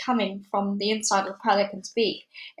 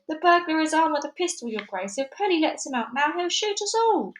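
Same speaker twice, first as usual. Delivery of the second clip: alarmed shout; 7 semitones higher than usual. A narrator's reading. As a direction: warn the Duke urgently. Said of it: "The giraffe shouted".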